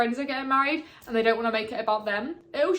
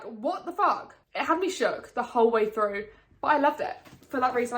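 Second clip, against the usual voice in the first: ordinary talk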